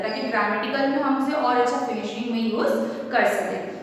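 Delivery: ordinary talk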